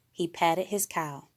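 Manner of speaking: usual speaking voice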